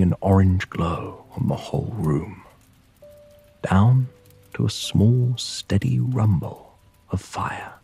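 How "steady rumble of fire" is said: usual speaking voice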